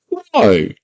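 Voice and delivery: very high whiny why; squeaky goblin why. Retold or imitated. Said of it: "Hago's like".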